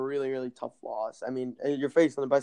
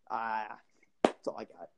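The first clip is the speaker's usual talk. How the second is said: whispered; 4 semitones lower, 6 dB quieter than usual. Real, not acted. Trails off at pick end.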